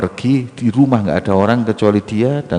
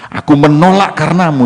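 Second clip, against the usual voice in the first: raised, high-pitched declaration to God; higher, louder acted appeal; emphatic mock plea; 7 semitones higher, 5 dB louder than usual. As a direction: proclaim it loudly in prayer. Acted boastful prayer to God.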